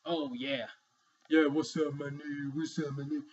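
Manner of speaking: mock casual greeting.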